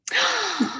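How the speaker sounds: sharp, high retold gasp; amazed gasp. Friend re-enacting hearing about the pie, amazed gasp. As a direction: gasp in astonishment. Acts out her amazed reaction.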